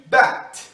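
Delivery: bat with a voiceless T, said slowly; English word demo. Shown as a demonstration. Teacher demonstrating bat for Brazilian learners, slow and clear. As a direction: pronounce bat with a crisp unvoiced T. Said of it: "é voiceless".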